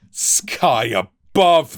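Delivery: captain exclaiming at the mutineer's folly. A narrator's reading. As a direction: burst out in exasperation. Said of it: "the captain exclaimed".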